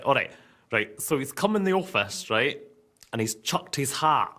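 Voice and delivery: ordinary delivery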